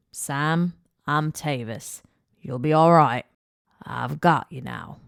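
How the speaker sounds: put-on reassuring man with an Australian accent; steady the boy in an Australian accent. A narrator's reading. Rescuer comforting injured boy, Australian accent. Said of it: "in an Australian accent".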